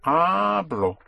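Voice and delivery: "Hablo" with HA high and drawn out; push, then surface. Shown as a demonstration. Teacher summing up the present, "Hablo" stressed high and long on HA. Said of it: "So for the present, you push".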